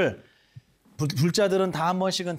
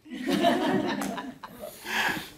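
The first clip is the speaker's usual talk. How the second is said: 소리 없는 웃음; 14 semitones higher, 4 dB quieter than usual. Real, not acted. 무음의 웃음.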